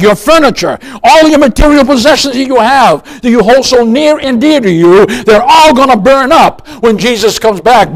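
usual speaking voice

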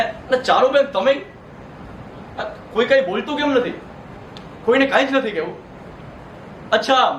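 usual speaking voice

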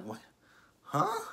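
sharp high squeak of disbelief; baffled yelp at the screen; shocked huh. Real, not acted. Reacting to threat scene.

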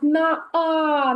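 ordinary delivery

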